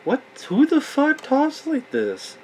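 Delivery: real disbelief at the dialogue; incredulous. Real, not acted. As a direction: stretch it out in disbelief. Mocking a movie character's line.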